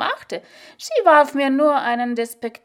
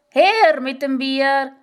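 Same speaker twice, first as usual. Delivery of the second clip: put-on a demanding old woman's slow, louder call; called out loudly; 3 semitones higher, 4 dB louder, slower than usual. A narrator's reading. Old nun calls for her beer the moment the door shuts, loud demanding call. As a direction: bark it out, she wants her beer now. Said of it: "Lina hat immer schon laut ausgerufen".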